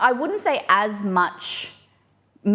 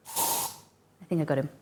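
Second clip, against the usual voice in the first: usual speaking voice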